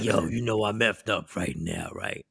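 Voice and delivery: low, soft, slurred sleepy mumble; groggy drugged voice. Retold or imitated. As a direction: sound doped up on painkillers. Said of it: "called me in a groggy, drug-induced".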